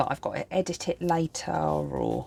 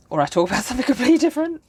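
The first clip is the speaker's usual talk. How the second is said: laughing; 9 semitones higher, 6 dB louder, much faster than usual. Real, not acted. Laughs mid-line, louder.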